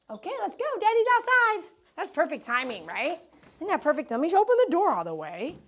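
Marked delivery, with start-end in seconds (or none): 0.1-4.1 much higher, louder sing-song cooing. Real, not acted. Groomer gushing to the happy dog that daddy arrived, high baby talk.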